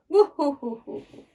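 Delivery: boo-hoo sobs, fading away; stagey pretend weeping; mock crying. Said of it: "वो भी रोने जा रही है". (रोने की नकल)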